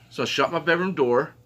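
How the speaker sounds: normal talking voice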